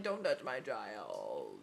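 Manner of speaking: very low, slow monster voice; hammed-up growly threat; mock menacing warning. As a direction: drop deep and drag it out. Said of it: "I'm just like".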